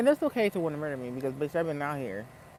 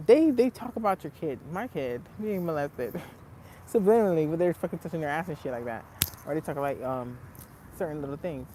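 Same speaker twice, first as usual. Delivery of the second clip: usual speaking voice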